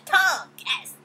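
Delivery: high loud gleeful whisper; loud high breathy cheer; excited cheer. Real, not acted. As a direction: hype the kiss up. Cheering the wedding kiss.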